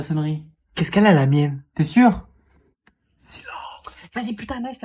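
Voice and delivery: hushed whispered command. Acted. Teacher hushing the noisy class, whispered. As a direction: hiss it under your breath.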